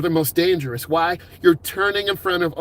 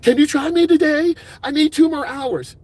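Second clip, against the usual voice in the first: pushy student; 11 semitones higher, 5 dB louder than usual. Acted. Student begging for extra lessons.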